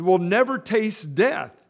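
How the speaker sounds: loud, slow, scornful challenge; loud mocking crowd voice; indignant accusers. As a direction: hurl the accusation at him.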